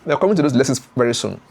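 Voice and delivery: usual speaking voice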